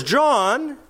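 high, slow, coaxing flattery; butter John up, leaning on "you"; flattering disciples. Acted. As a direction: disciples pleading with John about his standing. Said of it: "In other words".

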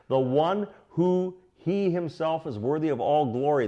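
ordinary talk

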